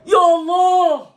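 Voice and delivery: long, high, loud cry of disbelief; excited exclamation. Real, not acted. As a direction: cry out at a near miss. Big chance, exclaiming.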